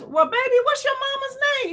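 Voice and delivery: high-pitched old woman impression; old lady voice. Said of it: "You know how old people talk".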